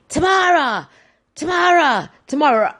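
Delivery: imitated calling-out, pitched well up; mock shout. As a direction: call for a lost sister down the aisles. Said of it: "I'm shouting out her name".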